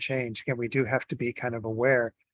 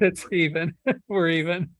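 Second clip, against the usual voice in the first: laughing; 6 semitones higher, 5 dB louder than usual. Real, not acted. Joking reply about God.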